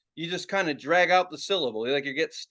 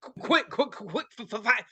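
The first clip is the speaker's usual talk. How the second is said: stuttering on quick, then a loud, high no, no, no; a hammed-up stutter breaking into protest; mock stutter; 6 semitones higher, 5 dB louder than usual. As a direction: stammer through it and give up loudly. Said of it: "say that with a stutter".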